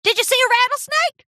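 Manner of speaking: high, loud call across water; shouting. A narrator's reading. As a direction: holler an alarmed question from afar. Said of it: "Applejack shouted from the water".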